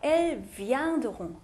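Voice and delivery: "Elle vient de Rouen" said slowly as el-vyan-duh-rong; pronunciation demonstration. Shown as a demonstration. Teacher shows students how "Elle vient de Rouen" sounds, slow pronunciation demonstration. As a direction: draw out "Elle vient de Rouen", final word a nasal "rong". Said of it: "어떻게 발음하나요?".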